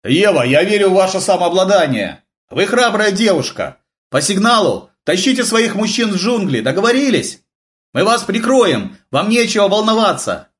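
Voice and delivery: high, projected rallying call; calling out encouragement. A narrator's reading. Major reassures Eva in the cave, calling out.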